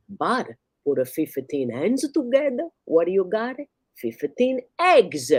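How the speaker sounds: high, slow Italian punchline; Italian accent. Acted. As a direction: land the punchline slowly, Italian. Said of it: "like a loud Italian".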